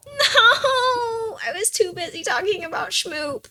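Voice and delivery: loud high wailing no, crying on; anguished wail. Real, not acted. Gamer blaming the chat for dying, anguished wail. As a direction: wail over the lost run. Character just died.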